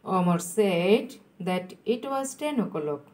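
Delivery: slow, with a pause mid-sentence; slow dictation. Shown as a demonstration. Teacher repeats for students still writing, slow dictation. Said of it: "আমি আস্তে আস্তে বলছি".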